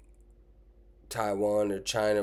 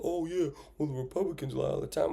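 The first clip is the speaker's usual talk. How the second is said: high, fast mock Republicans-lie whine; mock deflecting; 7 semitones higher, faster than usual. Mimicking people who deflect onto Republicans. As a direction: brush it off in a higher, faster voice. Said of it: "people cop up".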